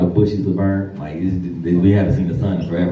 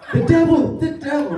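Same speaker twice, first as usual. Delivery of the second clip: high far-off pleading wail, much higher. Retold or imitated. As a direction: mimic a frightened preacher crying out. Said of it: "I'm like".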